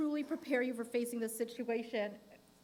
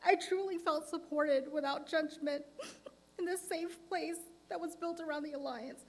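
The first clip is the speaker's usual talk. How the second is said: crying through the words, high and tight; snotty crying; 6 semitones higher than usual. Real, not acted. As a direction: voice breaking into tears. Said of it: "trying to hold myself together and not break down".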